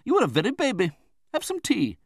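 high, loud, brisk matron; Betsy Trotwood. A narrator's reading. Aunt takes charge of the young widow, bossy. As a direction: pronounce it bossily, high and loud.

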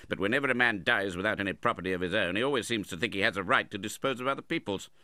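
irritable grumble. A narrator's reading. Uncle grumbles about his dead brother's demands, irritable. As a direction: complain briskly with irritation.

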